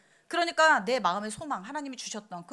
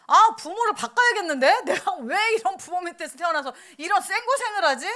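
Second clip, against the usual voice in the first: put-on higher, louder whining child voice with a laugh; sulky complaint; 7 semitones higher, 7 dB louder than usual. Grown child grumbling about the parents. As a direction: whine like an ungrateful grown child. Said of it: "grown child complaining about parents".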